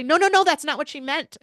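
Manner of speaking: imitated emphatic protest; insistent correction. Parents interrupt to correct her.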